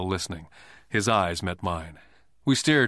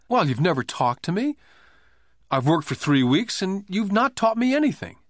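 pouting; 8 semitones higher than usual. A narrator's reading. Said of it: "I said with a pout".

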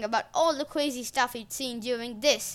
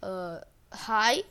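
usual speaking voice

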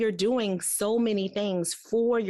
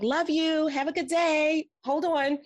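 rushed goodbye; 9 semitones higher than usual. Retold or imitated. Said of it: "I was like".

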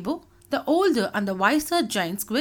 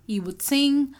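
usual speaking voice

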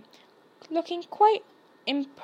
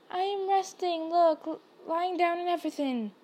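higher-pitched protest; mildly indignant plea; 5 semitones higher than usual. A narrator's reading. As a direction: insist in a higher voice. Harry protesting to the strict matron.

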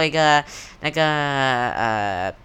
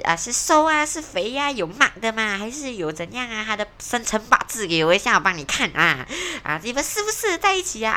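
nosy relative; 8 semitones higher than usual. Retold or imitated. Podcaster imitating a nosy relative quizzing about the partner, high and fussy. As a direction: pester like a busybody aunt prying about the partner. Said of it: "人家讲说".

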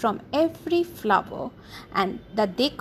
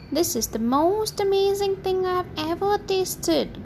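higher, delighted bear growl; 8 semitones higher than usual. A narrator's reading. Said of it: "growled the other bears".

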